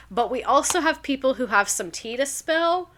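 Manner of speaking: ordinary talk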